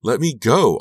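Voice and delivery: very loud high shriek. A narrator's reading. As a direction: scream to be released. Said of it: "I shrieked".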